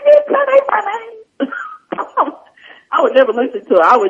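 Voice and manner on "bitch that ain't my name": very high, slow mock protest; indignant retort. Student refusing the teacher's name Carmen, mock indignant. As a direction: stretch out an exaggerated, high-pitched objection.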